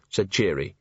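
plain reading voice

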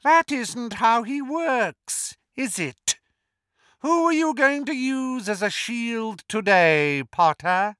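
sinister hiss. A narrator's reading. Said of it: "Voldemort hissed".

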